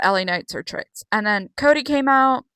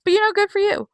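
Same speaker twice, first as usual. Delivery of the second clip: mock polite; 8 semitones higher than usual. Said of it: "I was like".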